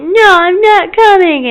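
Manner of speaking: high, loud, slow wail; imitated child's protesting cry; child's refusal. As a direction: refuse like an upset kid. Upset child refuses to come out.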